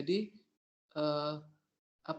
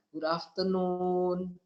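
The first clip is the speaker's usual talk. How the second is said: slow "Good afternoon" in a careful English accent; English greeting demo; much slower than usual. Shown as a demonstration. Teacher modelling a British greeting slowly in an English accent. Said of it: "British sama Amerika mungkin".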